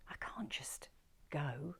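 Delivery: slow unvoiced whisper; slow whisper of a hesitant wife in a taxi. A narrator's reading. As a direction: breathe it slowly, barely daring.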